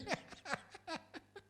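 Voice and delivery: a short amused laugh; high laugh. Real, not acted. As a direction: burst of high laughter at his own joke. Laughs after joke.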